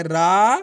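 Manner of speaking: रा held long and loud; stretched syllable. Shown as a demonstration. Teacher sounding out Ramu, रा stretched long. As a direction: draw out the aa of रा forcefully. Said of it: "रा का उच्चारण खेच को रहे".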